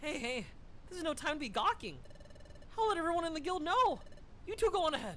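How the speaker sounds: fast, loud and high; rally the team loudly; loud excited orders. Acted. Character rallies loudly.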